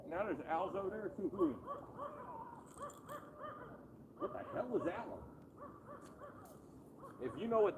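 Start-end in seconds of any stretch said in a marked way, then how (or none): 1.2-3.8 owl hoot imitation.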